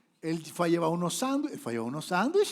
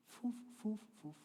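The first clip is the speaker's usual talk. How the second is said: blowing puffs; 6 semitones higher, 12 dB quieter, slower than usual. Retold or imitated. Devil blowing sound.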